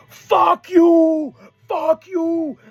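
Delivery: high, drawn-out imitated shout; mock screaming. Recapping Andrade screaming at a fallen opponent, mock scream. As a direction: yell it up high, as an insult across the ring. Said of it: "What are you doing screaming at him?".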